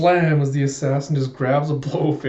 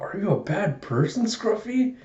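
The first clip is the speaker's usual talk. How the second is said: put-on little girl's wary question, rising high at the end; childlike questioning; 7 semitones higher, 3 dB quieter than usual. Small girl questions a sneering man, childlike. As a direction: ask like a wide-eyed child. Anya asks Frankie, wide-eyed.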